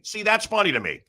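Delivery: ordinary talk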